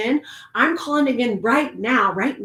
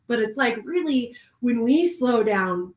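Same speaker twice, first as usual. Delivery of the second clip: ordinary talk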